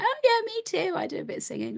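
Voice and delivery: telling a singer she sings too, lightly mocked; mock breezy boast. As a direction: breezily claim to sing too, pitch jumping up. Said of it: "I was like".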